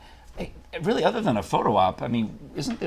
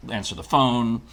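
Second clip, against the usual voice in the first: ordinary talk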